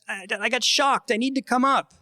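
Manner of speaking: high, slowed mock yell with a stammered start; re-enacted plea called up the cliff; calling up. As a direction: imitate a kid calling up to Dad. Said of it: "I yelled up to him".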